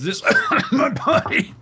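coughing mid-phrase, high-pitched; tosse simulata. Acted. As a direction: break the priest's words with a mock cough. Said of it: "and has to cough".

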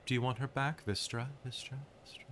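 soft, cold feminine spirit, names trailing off in echoes; ethereal cold voice. Acted. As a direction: tempt them gently, letting each repeated word fade. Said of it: "the blue flame is speaking with this very ethereal voice".

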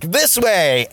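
enthusiastic. Retold or imitated. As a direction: cheerfully lead the way. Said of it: "very enthusiastically".

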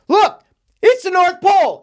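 high, very loud mock scream; overacted kid screaming his discovery; imitating a scream. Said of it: "And he, like, screams".

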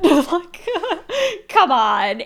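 high, loud laughing protest; laughing disbelief. Real, not acted. Laughing at friend's claim.

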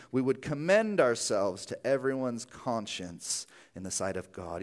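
usual speaking voice

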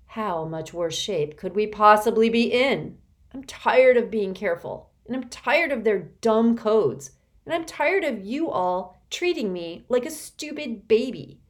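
loud, escalating angry rant; boiling over with resentment; furious outburst. A narrator's reading. Constance lashing out at the others, furious.

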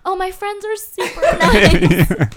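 loud, high, drawn-out laughing quote; hammed-up, laughing sweet talk; mock gushing. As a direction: gush it sarcastically through laughter. Teases friend with imagined gushing quote.

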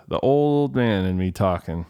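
ordinary delivery

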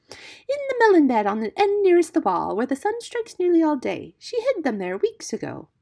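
high, loud singsong bird voice; high-pitched feather-brained bird; exultant songbird. A narrator's reading. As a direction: gush the news like a giddy bird. Voices Darzee the silly bird.

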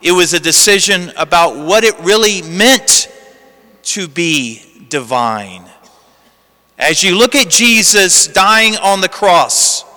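ordinary talk

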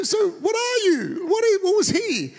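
hammed-up retold astonishment; gleeful disbelief. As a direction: demand an answer in high-pitched amazement. Retold gleeful questioning of his wife.

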